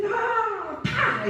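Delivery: very high cry then a slap sound; high yelp of pain. Retold or imitated. Preacher acting her pained shout at the German woman. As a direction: shriek it as the pain hits. Abscess popped, she strikes back.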